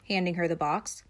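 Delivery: usual speaking voice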